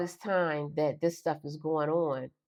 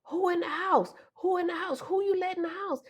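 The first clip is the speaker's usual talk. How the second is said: mother's frantic demand; 12 semitones higher than usual. Retold or imitated. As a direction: bark the question like a paranoid mother. Mother interrogating while beating child.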